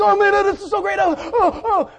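high, loud, giddy dog voice; excited dog's thoughts. Retold or imitated. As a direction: pant it out like an overjoyed little dog. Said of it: "if you could just read their mind".

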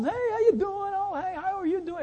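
high-pitched social hello; cheery greeting. Retold or imitated. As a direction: greet guests in a high, lively voice. Acted greeting to gathered guests.

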